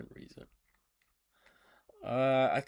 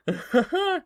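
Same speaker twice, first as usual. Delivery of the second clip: high burst of laughter; 10 dB louder than usual. Real, not acted. Laughs at tall tree.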